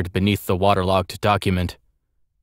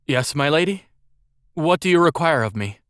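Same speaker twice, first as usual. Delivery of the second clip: put-on higher, questioning boy's voice; puzzled servant boy; 7 semitones higher than usual. A narrator's reading. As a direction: answer your mistress as an unsure servant. Servant boy answers his mistress, puzzled.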